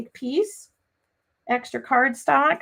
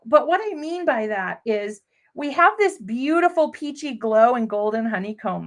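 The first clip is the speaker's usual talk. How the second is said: normal talking voice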